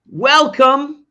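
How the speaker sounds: big high show-host welcome; announcer voice. Acted.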